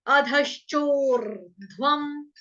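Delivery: अधश्चोर ध्वम stretched slowly; slow recitation. Shown as a demonstration. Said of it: "इसको मैं हटा रही हूँ".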